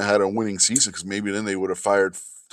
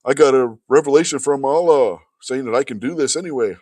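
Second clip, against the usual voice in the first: high, loud mocking sing-song; mocking Muhammad voice; 7 semitones higher, 7 dB louder than usual. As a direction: parody a smug self-serving prophet. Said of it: "Anytime Muhammad wanted something".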